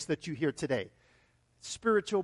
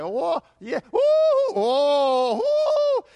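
rollercoaster shouts; 10 semitones higher, 10 dB louder, much slower than usual. Shown as a demonstration. Pastor acts the walk with Jesus, loud whooping shouts. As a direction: ride the highs with shouts. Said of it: "kinda more like this".

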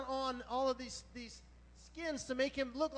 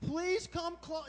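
put-on suspicious old father; 6 semitones higher, 3 dB louder, slower than usual. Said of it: "see, he's suspicious".

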